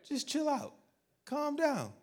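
high, soft and soothing; calming Jesus. Retold or imitated. Jesus calming Martha in the kitchen, high and soft. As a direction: settle her down gently. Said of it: "I'm paraphrasing".

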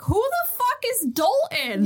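high-pitched mock bafflement; exasperated retelling. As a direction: act out a baffled, exasperated demand. Acting out baffled, exasperated Zoro.

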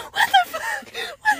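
very high, laughing mock disbelief; retold bewilderment. Husband baffled by sleep-talking wife, high mock disbelief. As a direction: squeal it as a baffled question. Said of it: "He said".

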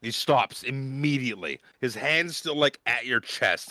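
usual speaking voice

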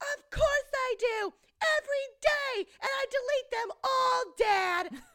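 high, slow hammed-up teen scream. Daughter admitting deleting emails to Dad, high screaming. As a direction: scream each phrase at Dad in mock fury. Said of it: "and she screams".

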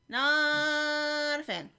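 slow, lingering thumbs-down; emphatic distaste. Real, not acted. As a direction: stretch the disapproval right out. Repeating dislike of the bed scene.

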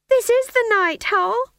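very high, loud wail; panicked cry. A narrator's reading. Cast to stage manager on opening night, high panicked wail. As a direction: wail it back in alarm.

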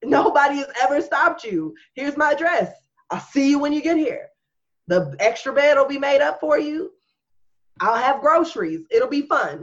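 high, mock-welcoming sarcasm; sarcastic. Sarcastic invitation to father.

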